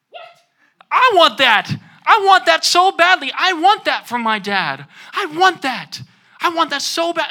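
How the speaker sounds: high, loud yearning cry; pleading longing. Retold or imitated. Recalling her envy of the film's father-son bond, high and loud. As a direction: plead with aching want.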